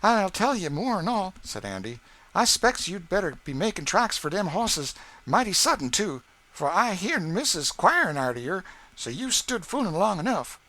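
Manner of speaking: put-on a lively stable boy hurrying Sam along; excited boy's voice, well above the narrator; Andy's voice. A narrator's reading. Andy voiced in the story.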